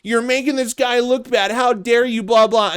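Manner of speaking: high, mocking imitation; a sneering send-up of an indignant scold; mocking Mike David. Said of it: "Mike will be like".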